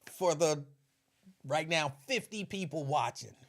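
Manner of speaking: ordinary talk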